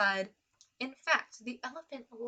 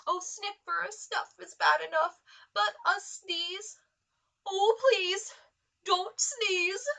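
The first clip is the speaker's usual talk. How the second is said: pleading animal voice; 8 semitones higher, 6 dB louder than usual. Acted.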